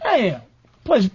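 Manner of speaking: normal talking voice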